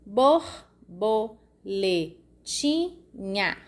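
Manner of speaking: "borboletinha" very slow, bor-bo-le-ti-nha; syllable by syllable. Shown as a demonstration. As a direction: separate each syllable of "borboletinha" for the class. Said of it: "Cinco sílabas".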